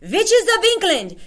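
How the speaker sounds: high, loud commanding shriek; Grand High Witch yelling. Acted. Grand High Witch yelling at the witches again. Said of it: "she yelled".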